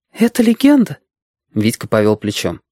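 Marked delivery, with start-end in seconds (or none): 0.1-1.0 put-on very high-pitched girl's question; curious girl. A narrator's reading. Girl character questions the tale. (любопытная девочка, высокий голос)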